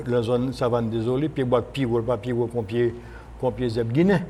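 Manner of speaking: ordinary delivery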